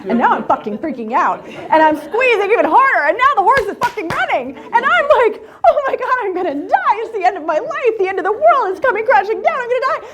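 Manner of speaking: high, loud hysterical wail; hammed-up panic. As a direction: wail it as if about to die. Retells panic as horse runs.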